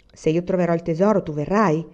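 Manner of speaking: ordinary delivery, nothing marked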